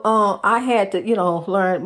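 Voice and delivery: normal talking voice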